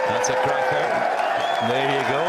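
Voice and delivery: loud, high, delighted exclamation; thrilled shout. Real, not acted. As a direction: cheer the shot with joy. Cheering a great shot.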